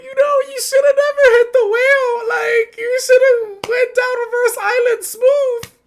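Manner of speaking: very high, loud mock crying; high loud fake sob-shouting; hammed-up sobbing wail. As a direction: wail it like a melodramatic mourner. Acting a sobbing lament at Usopp.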